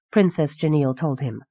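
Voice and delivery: ordinary delivery, nothing marked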